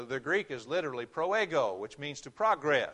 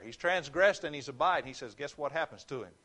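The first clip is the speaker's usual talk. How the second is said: usual speaking voice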